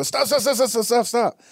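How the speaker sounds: high-pitched, rapid-fire repeated command; a burst of high, hurried stops; urgent retold stop. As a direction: reenact frantically halting a running child. Retold urgent halt to running son.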